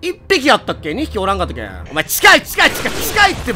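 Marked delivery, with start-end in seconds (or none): 1.9-3.5 high frantic repeated cries; panicked yelling. Real, not acted. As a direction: shout it as the enemy closes in. Enemy rushing him in game.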